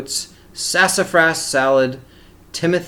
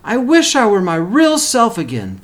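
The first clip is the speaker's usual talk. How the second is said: higher, rising urgent wish; Sylvester's wish; 7 semitones higher, 4 dB louder than usual. Acted. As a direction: plead the wish harder the second time. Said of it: "thought Sylvester".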